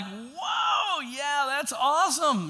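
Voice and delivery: high, loud delighted shout; excited whoop. Pretend. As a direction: cheer like the debt just vanished. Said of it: "Would you be like".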